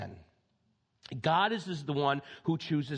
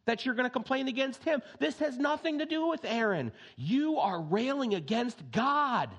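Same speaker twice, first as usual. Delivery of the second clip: high ringing rebuke from Moses; Moses rebuking; 6 semitones higher than usual. Acted. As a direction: confront the complainers with fierce conviction. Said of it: "At one point he says".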